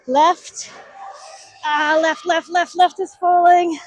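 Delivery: loud, high alarmed shouting; urgent shout. Real, not acted. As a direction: shout as the arm fails. Left arm failing mid-set.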